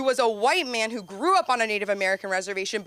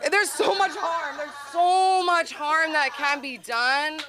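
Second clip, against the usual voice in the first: slow, higher, drawn-out ominous tone; mock gravity; 5 semitones higher, slower than usual. Warning the crowd about the dangers of travel, slow and mock-solemn. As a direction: play it solemn for the joke.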